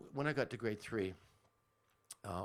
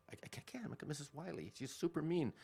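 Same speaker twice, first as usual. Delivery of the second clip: put-on pleading child; 7 semitones higher than usual. Child pleading with mom, acted.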